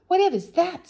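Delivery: high, loud alarmed question; startled. Acted. Bessie startled awake by an owl screech. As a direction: jolt awake and cry out.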